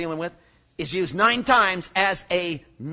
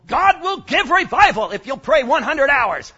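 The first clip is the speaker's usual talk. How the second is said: mock preachy claim; 7 semitones higher, 10 dB louder than usual. Mocking a pushy prayer teacher.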